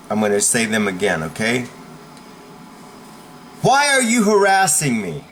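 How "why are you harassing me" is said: raised, high-pitched demand falling at the end; demonstrated indignant challenge; assertive challenge. Shown as a demonstration. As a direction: call out a bully forcefully. Demonstrates a phrase to use on bullies.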